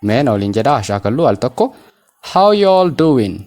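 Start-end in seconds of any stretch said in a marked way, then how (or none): 2.3-3.3 "How you all doing" slow, ending DOIN' without g; silent g. Shown as a demonstration. Teacher showing learners not to read g, slow reduced ending. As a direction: stretch the phrase, leave off the g of doing. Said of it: "Gaa kana dubbisuu hin qabnu".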